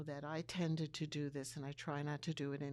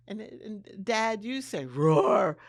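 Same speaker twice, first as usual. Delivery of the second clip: slow and much louder on roar; mock roar cue; 3 semitones higher, 11 dB louder, slower than usual. Quoting son telling dad to roar, slow and loud. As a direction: belt out the roar playfully. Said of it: "he said".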